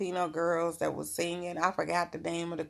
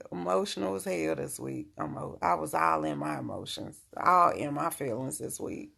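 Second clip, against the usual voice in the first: usual speaking voice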